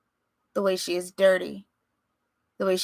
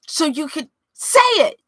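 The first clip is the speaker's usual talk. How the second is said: high, loud, tearful cry; weeping; 11 semitones higher, 8 dB louder than usual. Acted. Son weeping at his father, high tearful cry. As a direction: voice breaking with tears. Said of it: "Chico weeps".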